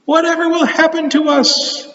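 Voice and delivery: worried high woman; high woman's voice. Acted. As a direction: cry it out, worried. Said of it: "one woman cried".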